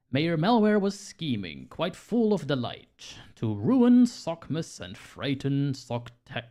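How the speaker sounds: slow, rhyming storybook read; story narration. A narrator's reading. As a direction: read it like a Christmas poem. Reads rhyming story intro.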